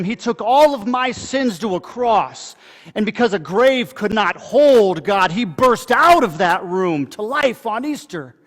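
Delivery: building, fervent proclamation; preaching build. Acted. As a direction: drive the sermon climax home. Sermon climax builds in force.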